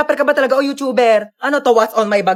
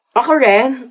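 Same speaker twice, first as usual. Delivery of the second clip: ordinary talk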